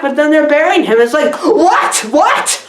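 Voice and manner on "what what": mock disbelief.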